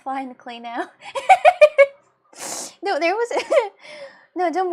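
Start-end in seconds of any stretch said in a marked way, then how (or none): 0.9-1.9 loud laugh. Real, not acted. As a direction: burst of high loud laughter. Streamer bursts out laughing.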